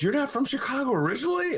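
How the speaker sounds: high, quick incredulous question; imitated astonishment. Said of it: "And she goes".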